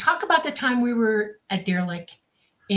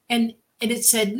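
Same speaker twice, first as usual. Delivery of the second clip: ordinary talk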